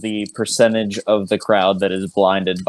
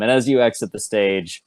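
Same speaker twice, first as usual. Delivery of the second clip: usual speaking voice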